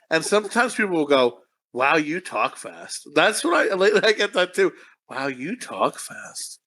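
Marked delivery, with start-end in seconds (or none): 1.7-3.1 slow imitation. People comment on her fast talking, slow imitation. 5.1-6.6 slow, low and soft; slow imitation. People comment on her fast talking, slow hushed imitation.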